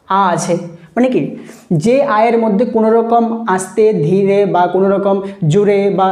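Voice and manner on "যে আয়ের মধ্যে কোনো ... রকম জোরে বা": normal talking voice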